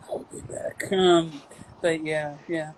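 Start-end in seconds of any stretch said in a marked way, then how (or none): 0.1-0.9 breathy whispered catchphrase. Pretend. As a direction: whisper it under your breath.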